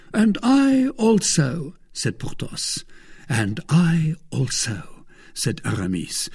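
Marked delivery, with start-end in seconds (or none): 0.1-1.8 slow, high-pitched, emphatic agreement; eager assent. A narrator's reading. Porthos joining Athos to follow D'Artagnan, eager assent. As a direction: chime in high and drawn out.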